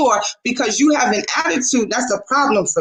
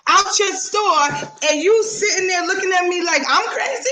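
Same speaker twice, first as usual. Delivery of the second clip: indignant; 6 semitones higher than usual. Retold or imitated. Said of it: "And I'm like".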